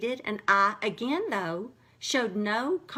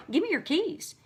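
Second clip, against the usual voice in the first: stern dad demand; 8 semitones higher than usual. Retold or imitated.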